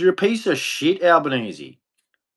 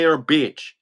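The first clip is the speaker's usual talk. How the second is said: ordinary delivery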